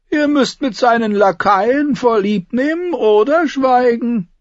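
high, cool and commanding; mocking courtly dwarf voice; imperious dwarf voice. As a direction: Tyrion handing down the king's terms. Tyrion's high voice relays the king.

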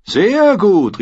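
high, loud exclamation of approval; delighted shout of approval; calling out praise. A narrator's reading. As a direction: call out your praise heartily. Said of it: "rief Tyrion".

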